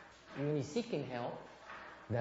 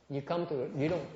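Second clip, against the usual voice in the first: ordinary talk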